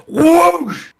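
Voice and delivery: high, quick imitated whoosh of the camera; whoosh sound. Gamer imitating the camera ripping around, high whoosh. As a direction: swoop the sound up fast.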